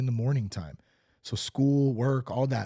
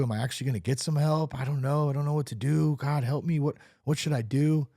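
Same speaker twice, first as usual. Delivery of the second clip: ordinary delivery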